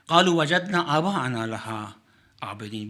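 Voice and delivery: fast, fluent Quranic recitation. Acted. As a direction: chant the verse briskly, reverently.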